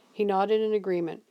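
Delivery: plain reading voice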